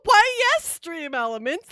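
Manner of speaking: high, loud hammed-up butler voice; mock grand reply. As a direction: answer like a pompous butler. Mock posh reply to chat.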